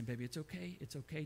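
soft, hushed comforting; retold soothing. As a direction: imitate a mother calming her baby quietly. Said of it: "just talking soothingly to her".

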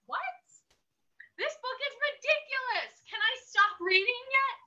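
high-pitched exasperated disbelief. Acted. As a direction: squeak it in outraged surprise. Exasperated reader protesting.

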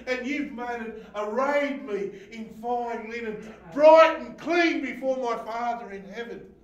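high, loud impassioned preaching; preaching at full force. Acted. Preacher crying out Jesus's words to the church. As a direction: preach it out to the back row.

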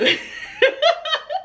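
high squeaky giggle; high laugh. Real, not acted. Laughing to viewers about picking an easy challenge. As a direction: giggle at yourself.

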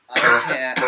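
loud sudden outburst. Real, not acted. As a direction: blurt it out loud. Loud outburst then trailing off.

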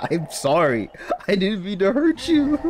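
high, helpless laughter through an apology; real giggles breaking up the words; laughing apology. Real, not acted. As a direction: apologize while cracking up. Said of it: "I don't know why I'm laughing".

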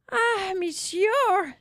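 high, slow, despairing plea; a grieving widow begging for help, voice lifted high; pleading widow. Acted. Widow pleading with the shopkeeper for help, high and slow.

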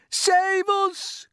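very high-pitched plea for rescue; panicked cry. A narrator's reading. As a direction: cry out as a terrified spider. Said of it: "cried the spider".

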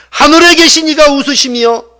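loud, high preacher's declaration; forceful proclamation. Acted. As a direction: proclaim the verse at full force. Verse proclaimed at full force.

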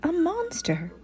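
high, hammed-up cry for help; mock fright. A narrator's reading. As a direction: pretend to be scared of the monster. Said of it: "she cried, pretending to be frightened".

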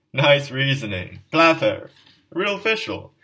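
loud, high mocking shouts; jeering crowd. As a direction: bark the jeers like tavern drunks.

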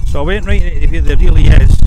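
loud to be heard outdoors; shouting over wind. Real, not acted. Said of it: "it's so windy up here".

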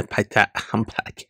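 normal talking voice

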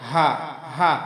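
"হা" breathed from the bottom of the throat; small ha. Shown as a demonstration. Teacher demonstrating the letter, deep-placed small ha. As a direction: start "হা" at the top of the windpipe. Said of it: "হলকে শুরু থেকে উচ্চারণ হবে".

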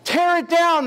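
high, loud furious cry. Acted. Exiles cry to God to destroy Edom, loud high furious cry. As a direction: shout for the city's ruin. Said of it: "they cried".

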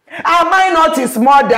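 loud, high, wounded demand; indignant shout. Acted. Mother shouting her hurt at the family.

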